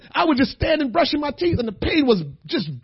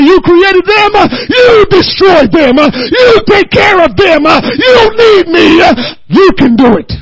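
louder, higher, slow, with a cry; God's voice, preached; 7 semitones higher, 10 dB louder, slower than usual. Retold or imitated. Preacher voicing God to the church, crying out. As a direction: proclaim God's words with a tearful shout. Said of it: "God said".

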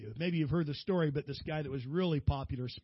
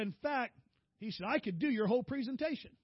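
son's voice; 7 semitones higher than usual. Retold or imitated. Son boasting he could present.

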